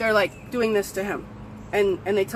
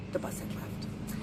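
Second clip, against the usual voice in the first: dramatic whisper; 17 dB quieter than usual. Retold or imitated. Narrator whispering the bad news of the missed bus. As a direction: breathe it out as a hushed whisper. Story climax, bus already gone.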